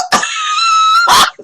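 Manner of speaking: very high, loud shrieking laugh. Real, not acted. Laughing at her own punchline, shrieking. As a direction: burst into a squealing laugh.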